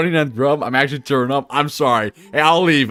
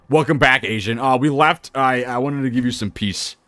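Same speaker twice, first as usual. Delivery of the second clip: ordinary talk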